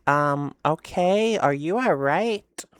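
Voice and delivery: slow, drawn-out puzzled question; imitating a baffled, careful reply; wary bafflement. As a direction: stretch it out, humoring someone odd.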